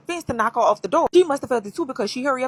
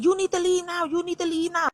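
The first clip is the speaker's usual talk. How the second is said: retold yell; 8 semitones higher than usual. Woman ordering the man out of her house, mock yelling. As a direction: imitate her urgent high-pitched demand. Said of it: "She's yelling".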